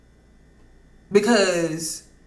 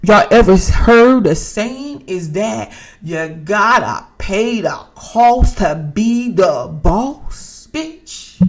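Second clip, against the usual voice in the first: slow, loud and drawn out, every word leaned on; loud slow preach; 7 dB louder, slower than usual. Real, not acted. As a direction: belt it out slow like a street sermon. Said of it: "I said".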